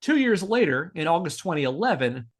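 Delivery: usual speaking voice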